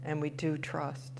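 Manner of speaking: quiet, low prayerful closing; soft prayer close. Acted. Minister closing the meditation, soft and prayerful. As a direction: ease the words down gently like a prayer.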